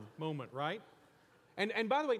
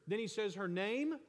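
ordinary delivery

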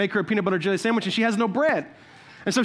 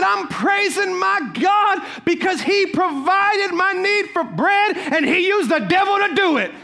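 high, louder triumphant punchline; triumphant answer; 8 semitones higher, 4 dB louder, slower than usual. Retold or imitated. Lady answering the neighbor, triumphant and laughing. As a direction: deliver the punchline with glee. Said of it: "She said".

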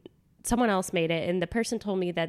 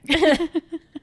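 high burst of laughter; 8 semitones higher, 8 dB louder than usual. Real, not acted. Laughing at friend's remark.

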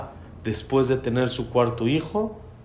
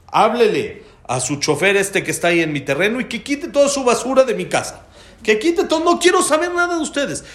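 very high, loud angry demand; cliente enojado imitado; 11 semitones higher, 5 dB louder than usual. Retold or imitated. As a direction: act out a furious customer ordering them off. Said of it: "sigue enojado y sigue peleando". (exigencia furiosa a gritos)

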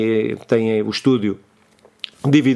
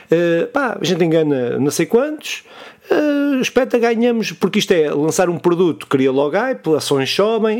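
hammed-up scheming shareholder, pitched higher; mock greedy executive; 6 semitones higher than usual. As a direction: play a greedy boss gleefully plotting profits, voice up high. (acionista ganancioso imitado, voz mais aguda e trocista)

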